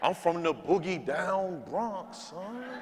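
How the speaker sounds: lightly voiced New York slang, drawn out; imitating cousins' New York talk. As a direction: mimic a cousin bragging New York style. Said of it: "they say stuff like".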